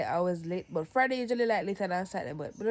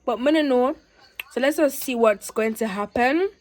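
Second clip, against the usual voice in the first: ordinary talk, nothing marked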